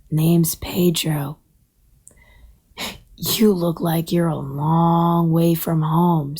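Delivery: low, very slow turtle voice; slow, low-pitched old creature; wise old turtle. Acted. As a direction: draw it out slowly, like an ancient turtle. Snapping turtle introduces himself.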